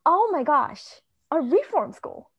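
high, loud burst of discovery; thrilled realization. Retold or imitated. Author retelling her moment of inspiration, thrilled. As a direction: exclaim it like a sudden brainwave.

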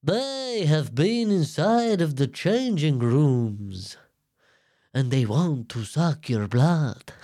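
very slow, hammed-up Romanian accent. Shown as a demonstration. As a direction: draw it out like a Romanian vampire. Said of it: "Romanian accent is more like a".

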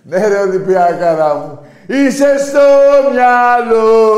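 very loud, high triumphant shout; exultant cheer. Real, not acted. Host cheering his team, a loud exultant roar.

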